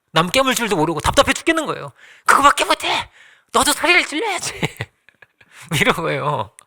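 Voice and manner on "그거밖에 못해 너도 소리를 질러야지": pushy parent. Retold or imitated. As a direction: press the timid child hard, like an impatient parent. 사자 부모가 아이를 다그침. (답답한 부모가 아이를 높은 소리로 다그치듯)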